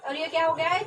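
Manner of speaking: ordinary delivery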